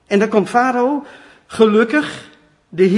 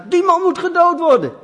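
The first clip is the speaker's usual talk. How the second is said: indignant outburst; 11 semitones higher, 7 dB louder than usual. Acted. David condemns man in Nathan story.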